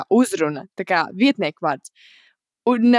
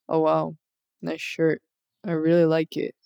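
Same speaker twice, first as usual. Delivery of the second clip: low, quiet, unimpressed monotone; deadpan text voice; 4 semitones lower, 5 dB quieter than usual. Shown as a demonstration. Friend showing how the compliment reads through text, deadpan. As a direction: read it like an emotionless text message. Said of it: "through text it would be like".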